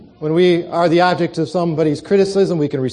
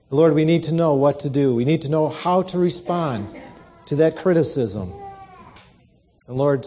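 soft, reverent prayer voice that sinks lower at the close; 4 semitones lower, 3 dB quieter than usual. Acted. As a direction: pray to the Lord quietly and humbly. Opening prayer before sermon.

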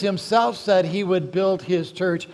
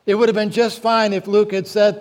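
normal talking voice

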